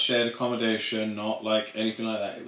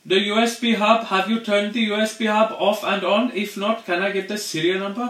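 much higher, louder agent voice; mock IT agent; 10 semitones higher, 4 dB louder than usual. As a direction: parody the scripted support agent, singsong. Mocking IT support agent.

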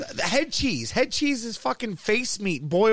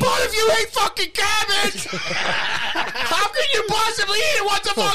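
laughing shout; 10 semitones higher, slower than usual. Real, not acted. Laughing shout over cabbage.

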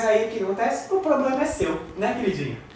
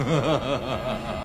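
laughing; 7 semitones lower, 4 dB quieter than usual. Real, not acted. Laughs at the accusation.

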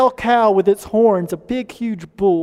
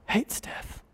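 whispered "hates death", much softer; 14 dB quieter than usual. Real, not acted. Preacher to the church, hushed whisper.

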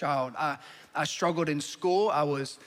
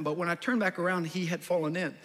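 ordinary talk, nothing marked

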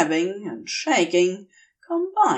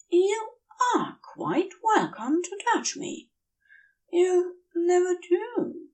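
higher, hesitant and earnest; tender angel confession; 7 semitones higher than usual. A narrator's reading. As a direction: offer yourself shyly, voice pitched up. Aziraphale's confession.

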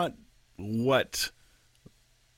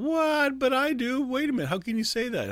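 much higher, squeaky mock outrage; listeners' hammed-up indignant protest; protesting listeners; 4 dB louder than usual. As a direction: play the offended churchgoer, up high. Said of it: "some of the rest of you are going".

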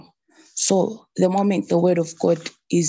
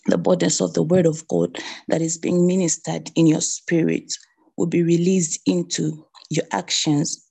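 normal talking voice

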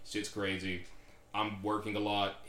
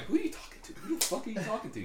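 mock bafflement; 10 semitones higher, 4 dB quieter than usual. Acting out baffled movie bystander.

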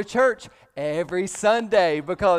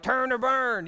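a hint of a bullhorn street preacher, a little higher; mock street preacher; 5 semitones higher than usual. Pastor mocking a highway-corner preacher with a bullhorn, lightly hammed-up warning. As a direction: lightly ham up a corner doomsayer. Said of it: "the guy on the corner ... with a bullhorn".

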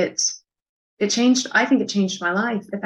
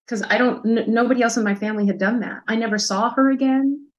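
ordinary delivery

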